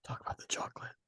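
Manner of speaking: unvoiced stage whisper; hushed prompting whisper. Real, not acted. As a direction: whisper the prompt so only your co-host hears. Prompting co-host secretly.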